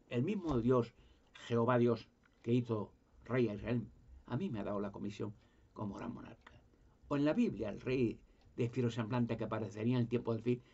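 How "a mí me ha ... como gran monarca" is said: whispered, confiding; soft whispered claim of a chosen mission. Real, not acted. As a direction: hush it like a secret revelation. Secret claim to divine mission.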